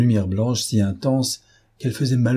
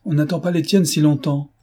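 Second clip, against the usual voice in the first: higher-pitched, instant comeback; quick retort; 6 semitones higher, 4 dB louder than usual. A narrator's reading. Dosia answering her cousin's jibe, sharp retort. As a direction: fire back at the teasing. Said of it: "partit comme un coup de pistolet".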